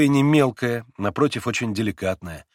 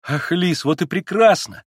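Alyosha's voice lifted higher and louder in delight; young man suddenly overjoyed; joyful exclamation; 7 semitones higher, 6 dB louder than usual. Acted. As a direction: exclaim it with happy relief. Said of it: "радостно воскликнул Алёша".

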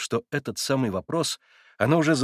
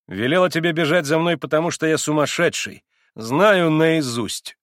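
higher, louder, irritated retort; 6 semitones higher, 6 dB louder than usual. A narrator's reading. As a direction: snap it out, fed up. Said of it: "раздражительно прибавил он". (раздражённо, с досадой)

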